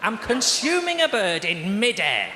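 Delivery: high, slow, hammed-up wonder; mock awe. Comic marvelling at eating chicken on a plane, slow and awed. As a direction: marvel grandly at the absurdity.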